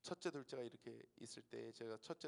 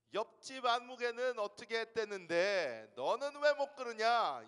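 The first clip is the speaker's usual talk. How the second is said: nagging parent; 10 semitones higher, 13 dB louder than usual. Retold or imitated.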